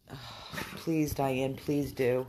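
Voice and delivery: ordinary talk, nothing marked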